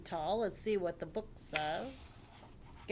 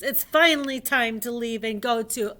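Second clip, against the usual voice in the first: loud, hearty dinosaur papa; booming voice; 3 semitones higher, 10 dB louder than usual. A narrator's reading. Papa Dinosaur announcing the family's outing, booming voice. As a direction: project it like a big dinosaur. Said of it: "in his loud, booming voice".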